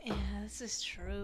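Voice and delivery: whispered aside, no voice. Real, not acted. Whispered agreement that Chase failed at life. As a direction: breathe it as a secret.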